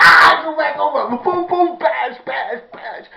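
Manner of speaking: loud, high, excited fight retelling; hammed-up brawl shouting; shouted fight re-enactment. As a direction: yell it like throwing punches. Acts out beating with hit noises.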